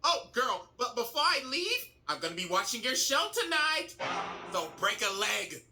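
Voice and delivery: high, sugary veiled threat; fake sweetness. Azula promises Ty Lee she'll watch tonight, falsely sweet. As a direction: sound sweet with menace underneath.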